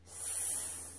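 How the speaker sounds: S as a long voiceless "sss"; drawn-out S sound. Shown as a demonstration. Teacher gives pupils the first column's sound, long hissed S. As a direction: sustain the hiss of S alone. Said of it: "quand vous entendez".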